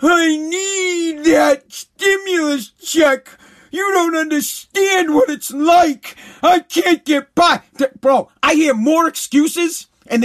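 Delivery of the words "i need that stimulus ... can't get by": slow, whining mock plea, higher and crying; mock the whiny excuse-maker; whiny lazy class. Mocking a lazy person begging for the stimulus check, in a slow whine. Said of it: "my perfect example of the lazy class".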